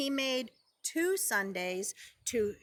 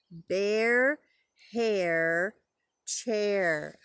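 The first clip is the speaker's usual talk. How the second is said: "Bear", "hair", "chair" each stretched alone, last one falling; rhymes sounded out; 5 semitones lower, 3 dB louder, much slower than usual. Shown as a demonstration. Teacher noticing a rhyme with preschoolers, rhymes sounded out. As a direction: draw out "Bear hair chair" with pauses, rising until the last. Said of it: "They all rhyme".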